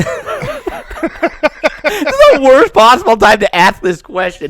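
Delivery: high laughing protest with mouth on fire. Real, not acted. Laughing through burning mouth.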